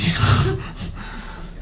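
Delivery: high airy laugh. Acted. Mock reaction.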